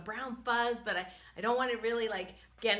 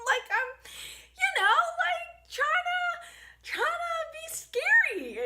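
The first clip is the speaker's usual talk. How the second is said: high-pitched, laughing through the words; 6 dB louder than usual. Real, not acted. Wife explaining her roaring, laughing high. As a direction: giggle while explaining. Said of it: "laughing retelling".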